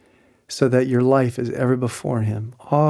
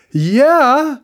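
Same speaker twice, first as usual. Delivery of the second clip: excited yeah; 13 semitones higher, 7 dB louder than usual. Retold or imitated. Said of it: "group reacts".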